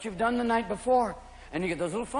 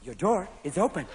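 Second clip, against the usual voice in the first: lightly voiced car announcement, softer; soft, lowered car-warning voice; talking car; 4 semitones lower, 5 dB quieter than usual. Car warning the stoned driver, soft announcement. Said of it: "Japanese cars where you open the door and it goes".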